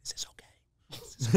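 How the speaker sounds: anxious whispered question; nervous whisper. Retold or imitated. George whispers nervously to his seatmate during turbulence. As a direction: whisper it scared of the bumps. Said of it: "George goes like this".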